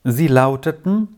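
plain reading voice

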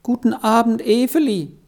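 high, choked-up greeting through tears; sobbing greeting. A narrator's reading. Beni greets Efeli while weeping, sobbing high. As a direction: force the words out between sobs. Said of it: "konnte vor Schluchzen kaum".

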